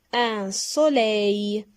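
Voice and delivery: Un soleil said slowly, each syllable drawn out; slow model word. Shown as a demonstration. Teacher models a word for the class to repeat, slowly. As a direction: stretch out Un soleil for pupils to repeat. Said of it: "Répétez".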